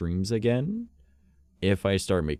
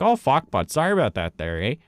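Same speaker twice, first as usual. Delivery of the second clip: hammed-up Canadian accent, higher and louder; 7 semitones higher, 5 dB louder than usual. Playing a Canadian in Skyrim, a jokey Canadian accent. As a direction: play a mock Canadian who apologizes after every hit. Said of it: "but I'm Canadian".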